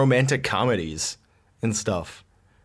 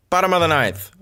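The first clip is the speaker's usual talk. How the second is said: put-on higher, louder old-timey announcer; sports announcer; 6 semitones higher, 4 dB louder than usual. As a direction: call it like a radio announcer. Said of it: "something that you would say".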